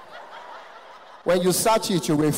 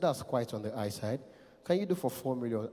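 low, softer, thick-voiced tycoon; rich man; 8 semitones lower, 10 dB quieter than usual. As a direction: make the enquiry deep and understated. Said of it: "I heard the voice of a rich man".

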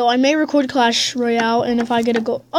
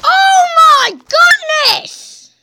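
high shrieking yell, then breath out; 7 dB louder, slower than usual. Real, not acted. Gamer yells at the gold chest pull. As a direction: scream at the epic from the chest. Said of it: "I am literally going to yell".

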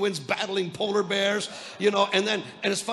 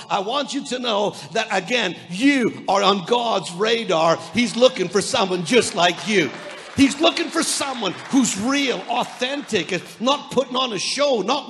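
preaching at full force; 6 dB louder than usual. Acted. Preaching climax.

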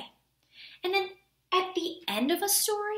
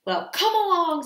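loud, raised challenge; loud big-goat voice; 3 semitones higher, 6 dB louder than usual. A narrator's reading. Big goat challenging the troll, loud and bold. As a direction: dare him on loudly. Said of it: "his voice was as loud".

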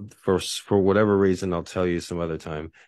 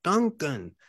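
much higher, excited Duncan Duncan; light up with sudden joy; excited recognition; 12 semitones higher, 4 dB louder, much slower than usual. Retold or imitated. Micronesians recognizing Tim Duncan, excited and high. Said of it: "then they're like".

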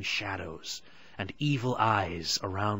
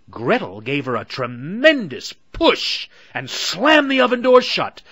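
louder, rising, excited narration; dramatic climax; 8 semitones higher, 12 dB louder than usual. A narrator's reading. Narrator tells the oven push, building excitement. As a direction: build it to the slam.

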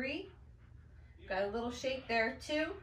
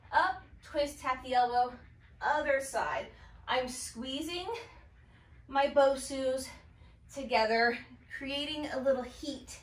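ordinary talk

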